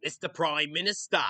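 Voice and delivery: hammed-up broad Australian accent; exaggerated Australian twang. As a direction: call out in a cartoonish Aussie accent. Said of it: "make a stereotypical Australian voice".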